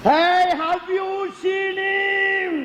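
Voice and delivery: high, slow, drawn-out shout of Hey. Acted. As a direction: call it out slowly at the top of the voice. Shouts the repeated question. (உரத்த கூச்சல்)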